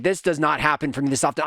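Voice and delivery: ordinary delivery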